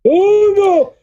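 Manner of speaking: high, drawn-out cartoon wail; panicky cartoon impression. Cartoon kid fearing Sarah's anger, high panicky voice.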